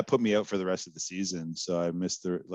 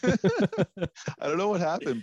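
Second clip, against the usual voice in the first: higher, slow, laughing it out; 10 semitones higher, much slower than usual. Real, not acted. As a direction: laugh through the words. Laughs at own back injury.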